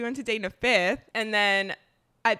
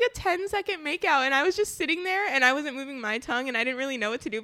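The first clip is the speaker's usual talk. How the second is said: normal talking voice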